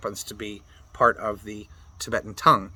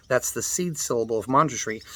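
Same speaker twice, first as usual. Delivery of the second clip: ordinary delivery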